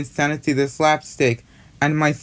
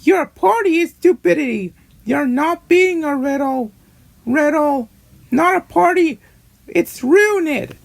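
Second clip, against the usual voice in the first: high-pitched, indignant outburst; angry marshmallow; 13 semitones higher, 3 dB louder than usual. Acted. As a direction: act out an angry character in a very high voice. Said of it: "Marshmallow getting angry said".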